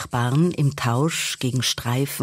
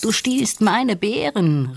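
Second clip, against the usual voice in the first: high, indignant cry of accusation; raised-pitch storybook outcry; calling out; 7 semitones higher than usual. A narrator's reading. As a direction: call out in protest, pitched well up. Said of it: "rief Nandi".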